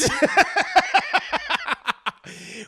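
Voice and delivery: loud, high cackling laugh; real laugh. Real, not acted. Streamer cracks up at the story, real laughter. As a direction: burst out laughing for a long stretch.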